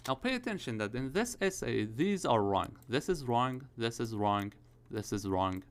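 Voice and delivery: usual speaking voice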